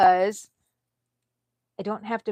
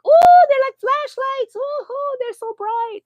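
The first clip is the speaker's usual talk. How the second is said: very high, loud mock-amazed squeal; teasing squeal; 13 dB louder, slower than usual. Teasing her daughter about pale legs, high loud mock squeal. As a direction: squeal like you're blinded. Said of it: "I'm like".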